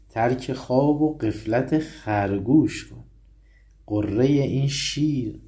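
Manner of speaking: measured recitation; poem recitation. Acted. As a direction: deliver the warning line gravely. Recites Masnavi verse.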